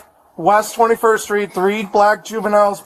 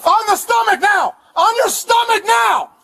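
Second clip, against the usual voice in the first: high barked order; shouted command; 10 semitones higher than usual. Real, not acted. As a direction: order them down, yelling. Officer commanding suspects.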